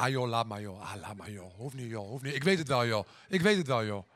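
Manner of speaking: low, mumbled, embarrassed waving away; uncomfortable brush-off. Retold or imitated. Daughter sparing her speechless mother, uncomfortable brush-off. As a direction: imitate someone awkwardly brushing off a compliment. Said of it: "Die vond het heel ongemakkelijk".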